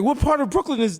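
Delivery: very high, baffled voice; hammed-up incredulous squeal; mock panicked question. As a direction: ask like a hopelessly lost visitor. Lost visitor asking locals.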